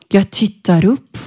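ordinary talk, nothing marked

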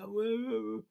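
высокий растерянный лепет; flustered stammer. Retold or imitated. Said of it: "You're going".

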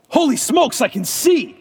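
high, loud amazed cry of joy; excited exclaim. Retold or imitated. A woman whose eye is healed cries out in high, loud amazement. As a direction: shout it like sight just came back.

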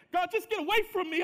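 loud, high cry of get away; anguished exclamation; exclaimed in despair. Acted. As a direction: shout it as a man ashamed. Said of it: "said with an exclamation point".

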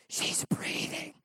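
hushed, slow whisper of wonder; slow awed whisper beside the crib. Retold or imitated. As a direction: whisper it so the baby stays asleep. Marveling at sleeping baby.

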